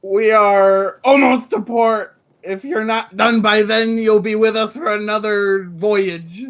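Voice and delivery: loud, commanding ship cook barking orders; bossy chef voice. Acted. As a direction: order the deckhand around like a gruff chef.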